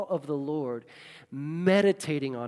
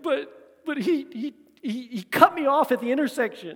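whiny complaint; 8 semitones higher, 5 dB louder than usual. Retold or imitated. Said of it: "some of us are like".

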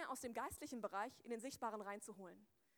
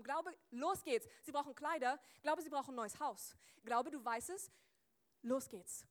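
urging command; 5 dB louder than usual. Acted. Commands faith like a coach.

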